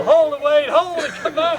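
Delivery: loud, high call across the road; retold yell, high and loud; yelling after him. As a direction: shout to stop him running off. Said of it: "We yell".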